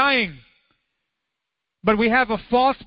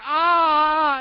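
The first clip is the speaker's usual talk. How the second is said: put-on high startled yelp of a little boy; boy yelping as mom dabs on antiseptic, high yelp; child's yelp; 8 semitones higher than usual. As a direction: yelp like a kid who just got stung. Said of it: "He's confused. He's like".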